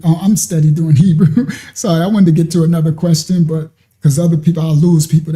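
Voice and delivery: ordinary talk, nothing marked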